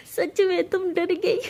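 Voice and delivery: teasing through laughter; laughing. Acted. Rose laughs at her scared friend, teasing. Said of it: "ज़ोर ज़ोर से हंसी है".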